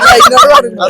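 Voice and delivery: very high, shouted complaint; loud protest. Real, not acted. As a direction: yell it high amid the laughter. Teasing outcry, others laughing. (ഉയർന്ന ശബ്ദത്തിൽ വിളിച്ചുകൂവി പരാതി)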